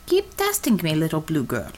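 a lightly voiced Russian woman, blunt and cocky; a light Russian accent, teasing the nervous driver; Russian commissar voice. Russian commissar teasing her driver, in a light Russian accent. Red Savior warns Belladonna.

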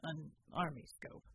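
low, steady soldier voice; deep measured military man; Bull's voice. Acted. Soldier Bull reporting to the team, low and steady.

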